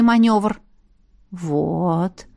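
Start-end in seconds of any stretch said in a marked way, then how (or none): 1.3-2.3 lower, soft, smiling approval; warm approval. A narrator's reading. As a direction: say it gently, pleased with the pupils. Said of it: "тепло улыбнулась Александра". (тепло, с улыбкой)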